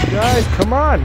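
frustrated shout. Real, not acted. Gamer scolding missing teammates, loud frustrated shout. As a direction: yell at the useless team.

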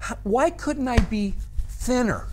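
whiny complaining. Acted. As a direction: whine it like a jealous kid. Envious person whining about looks.

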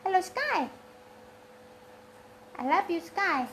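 high, soft pet-talk; affectionate cooing. Real, not acted. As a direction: coo sweetly to your pet. (sapaan sayang bernada tinggi)

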